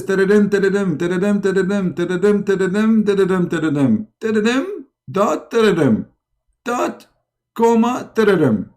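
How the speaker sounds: high, rolling nonsense dictation; high mock bell ringing; imitated bell sound. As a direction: rattle out bell noises as if they were a message. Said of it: "it will sound like this".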